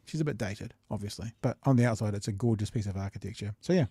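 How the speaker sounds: ordinary talk, nothing marked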